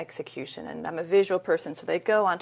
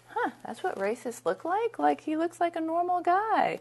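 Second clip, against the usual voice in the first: high-pitched, drawn-out inner musing; puzzled wonder; 10 semitones higher than usual. Retold or imitated. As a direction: act out naive bafflement, voice climbing higher and slowing. Other part of brain, puzzled.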